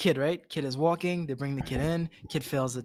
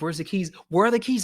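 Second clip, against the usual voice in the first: building demand; 6 semitones higher, 7 dB louder than usual. Retold or imitated. Guy demanding keys, retold scene.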